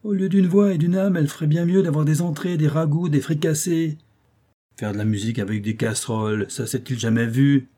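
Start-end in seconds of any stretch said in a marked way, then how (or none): 0.0-4.0 angry cook. A narrator's reading. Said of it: "répliquait le cuisinier en colère".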